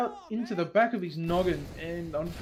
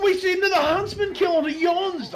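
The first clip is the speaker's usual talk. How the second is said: excited; 9 semitones higher, 5 dB louder than usual. Real, not acted. Caster reacts to a kill.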